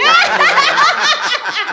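loud, high burst of laughter. Real, not acted. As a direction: crack up at the intro. Wife laughs at the intro.

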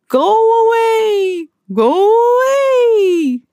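slow, high, loud spooky wail; rising ghostly moan. A narrator's reading. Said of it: "the ghosts moaned".

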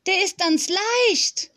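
put-on high, slow child's voice; little girl exclaiming. A narrator's reading. Small girl lifts a heavy table, exclaiming high. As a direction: call it out high and drawn out. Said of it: "rief die Kleine aus".

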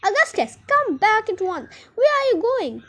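high, loud motherly shrieking; frantic, high-pitched storybook scolding; screaming. A narrator's reading. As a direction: scream after a runaway child. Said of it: "screamed Miss Gloop".

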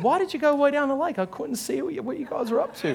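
high, quick questioning voice; lightly mimicked wife. Wife asking husband about the trip down the lake, mimicked questioning. Retells Paula's questioning after the ride.

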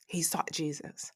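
whispered repeat; soft whispered echo; hushed emphasis. Real, not acted. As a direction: drop to a whisper to drive it home. Repeated line, whispered for effect.

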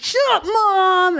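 high, slow bratty "Shut up, Mom"; whiny teen. Retold or imitated. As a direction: drag it out like a sulky kid. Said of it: "And you're like".

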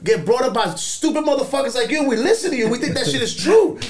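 loud, high, escalating rant; a furious rant with a laugh in it; going off. Retold or imitated. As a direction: let him have it, building up. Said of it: "I went off".

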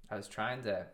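ordinary talk

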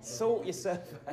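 put-on "Sort yourself out" giggled through in a soft British accent; laughing British accent. Rehearsing a British insult to friends, laughing softly. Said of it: "did I get that right?".

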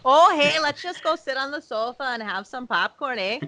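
hammed-up, high-pitched Midwestern folksiness; Midwestern accent. Mimicking Midwesterners inviting someone to the sofa, exaggerated Midwestern accent. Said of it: "the Midwestern one".

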